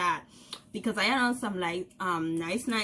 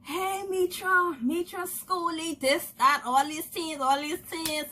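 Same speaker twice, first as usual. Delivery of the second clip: people calling out; 6 semitones higher than usual. Retold or imitated. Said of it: "long people calling my name".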